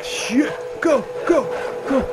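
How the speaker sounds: high, loud, urgent cries; panicked urging. Real, not acted. Player fleeing danger in the game, panicky. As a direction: urge the character on in a panic.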